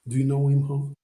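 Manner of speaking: ordinary talk, nothing marked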